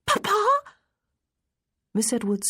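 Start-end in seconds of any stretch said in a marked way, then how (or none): 0.0-0.4 put-on very high child's cry filled with excitement; delighted high-pitched little girl; glad, excited. A narrator's reading. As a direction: call out gladly to a father just arrived. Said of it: "more gladly, filled with excitement".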